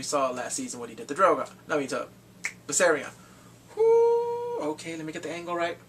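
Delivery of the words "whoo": loud, high excited whoop. Real, not acted. Fan whooping at the screen, loud and high. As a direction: let out a cheer.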